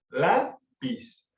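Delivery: "Lápiz" split in two, LÁ rising then piz dropped; stress on first syllable. Shown as a demonstration. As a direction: punch LÁ in "Lápiz", pause, then a light piz. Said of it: "la fuerza la tiene la primera sílaba". ("Lápiz" separado, con fuerza en LÁ)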